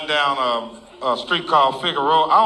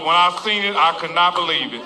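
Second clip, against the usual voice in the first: ordinary delivery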